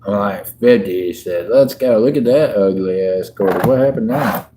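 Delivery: ordinary talk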